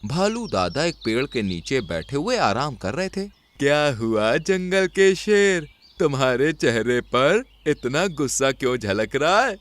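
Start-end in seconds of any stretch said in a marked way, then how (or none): 3.6-9.6 put-on unhurried old bear questioning the lion; old bear character. Voices old bear in story. (धीमी आवाज़ में बूढ़ा भालू)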